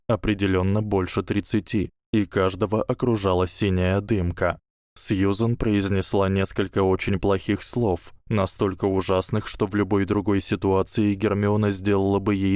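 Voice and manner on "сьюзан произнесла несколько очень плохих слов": ordinary delivery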